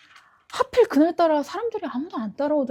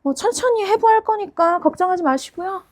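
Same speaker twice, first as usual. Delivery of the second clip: cheerful menace; 7 semitones higher, 6 dB louder than usual. Acted. Killer cheerfully promises slow dissection.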